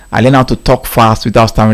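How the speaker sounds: quick, fluent repeat without stumbling; talking fast. Shown as a demonstration. Host re-reads a submission about talking fast, quickly. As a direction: rattle it off in one go.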